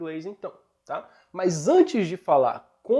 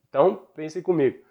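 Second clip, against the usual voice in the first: normal talking voice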